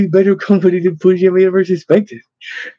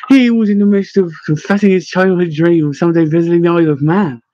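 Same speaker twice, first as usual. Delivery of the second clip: ordinary delivery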